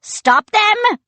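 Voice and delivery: high, loud order; a pirate yelling to stop the boys; shouting. A narrator's reading. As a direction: yell the command.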